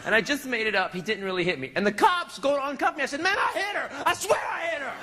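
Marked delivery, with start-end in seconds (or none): 3.2-4.9 high-pitched frantic insistence; mock desperate confession. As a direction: swear to it, voice pitched way up. Begs cops not to uncuff.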